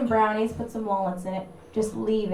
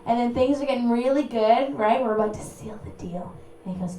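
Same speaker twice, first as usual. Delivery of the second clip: usual speaking voice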